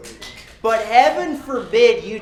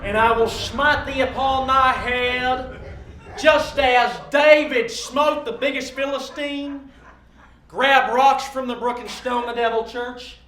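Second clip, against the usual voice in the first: mock biblical prophet; 4 semitones higher than usual. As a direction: thunder it out like an Old Testament prophet.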